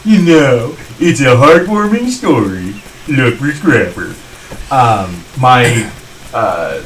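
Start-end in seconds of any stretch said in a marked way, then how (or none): 0.1-4.2 louder, drawn-out mock trailer pitch; odd put-on voice. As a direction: sell it slowly in a silly put-on voice. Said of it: "I don't know what that was about".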